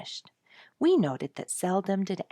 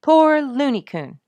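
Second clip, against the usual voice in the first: sympathetic; 9 semitones higher, 12 dB louder than usual. A narrator's reading. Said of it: "repeated Sonia".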